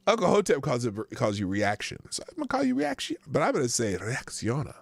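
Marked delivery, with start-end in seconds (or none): 4.0-4.8 put-on nickname said with a Spanish accent. Host trying out a viewer's nickname, put-on Spanish accent. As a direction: give the name a playful Spanish accent. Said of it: "with the accent and everything".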